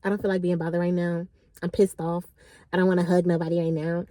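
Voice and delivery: low, soft grumbling refusal; sulky friend. Retold or imitated. Friend refusing a hug, low sulky grumble. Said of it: "she was like".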